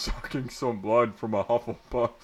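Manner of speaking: normal talking voice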